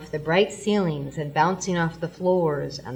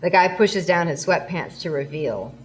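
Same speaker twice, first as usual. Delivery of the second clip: ordinary talk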